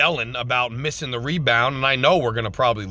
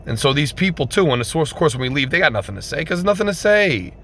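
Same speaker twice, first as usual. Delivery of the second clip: usual speaking voice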